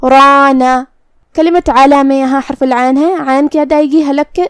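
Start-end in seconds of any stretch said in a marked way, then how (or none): 0.0-0.8 رانا with a full, heavy ra and a long alif. Shown as a demonstration. Teacher shows students the heavy ra. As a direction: say رانا with a thick, rounded ra. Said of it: "را کو اور مد الف کو دونوں کو تفخیم سے ادا".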